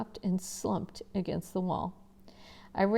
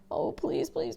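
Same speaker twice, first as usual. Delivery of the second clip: pleading; 9 semitones higher than usual. Acted. Boy still praying in the cold.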